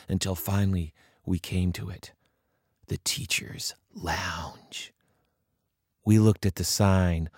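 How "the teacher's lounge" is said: slow, whispered with dread; hushed awe. Acted. Narrator reaching the forbidden door, whispered in awe. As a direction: breathe it like a forbidden place.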